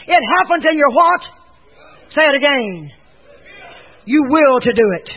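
loud, high, demanding question; forceful call-and-response; preach. Real, not acted. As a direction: press the congregation for the answer.

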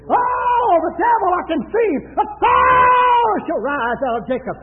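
high, loud preaching outburst; shouted prophecy. Retold or imitated. Preacher proclaims the star prophecy against the devil, shouted outburst. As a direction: cry it out, stretching the key word.

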